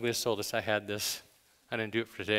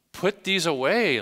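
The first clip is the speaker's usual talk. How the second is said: higher, louder and slow, every word weighed; emphatic command; 7 semitones higher, 7 dB louder than usual. Shown as a demonstration. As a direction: drive the command home from the pulpit. Preacher repeating the command emphatically.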